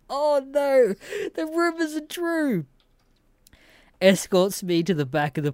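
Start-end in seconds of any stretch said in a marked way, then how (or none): 0.1-2.6 mock dismay. Retold own dismayed reaction.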